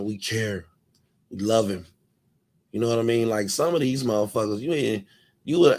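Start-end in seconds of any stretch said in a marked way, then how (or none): 0.0-1.9 soft, hushed mock sympathy; mock caring. Mocking the fans' fake care in a hushed voice. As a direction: parrot their fake concern under your breath. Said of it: "They have to tell you that they care".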